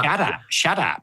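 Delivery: "shut up" said as "shaddup"; "shut up" with a D in place of the T; copied slangy pronunciation. Shown as a demonstration. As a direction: practise "shut up" as shaddap, slowly. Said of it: "S H A double D U P".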